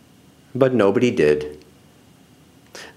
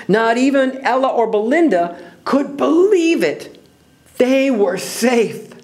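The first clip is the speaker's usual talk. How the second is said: high wonder, slowing at the end; high-pitched storyteller's marvel; amazed narration; 10 semitones higher than usual. A narrator's reading. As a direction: build the amazement, then draw out the relief.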